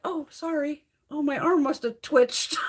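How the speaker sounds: high, mock-sheepish apology; feigned innocence. Feigned innocent apology after elbowing.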